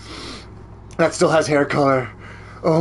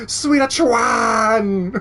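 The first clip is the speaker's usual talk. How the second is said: drawn-out shout; 8 semitones higher, much slower than usual. Real, not acted. Yelling Sweeta's name again.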